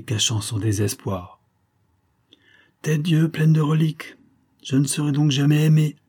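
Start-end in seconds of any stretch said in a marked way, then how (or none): 2.8-6.0 loud, high frustrated exclamation; exasperated outcry. A narrator's reading. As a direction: burst out at her tears. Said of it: "s'écria le comte". (exclamation exaspérée)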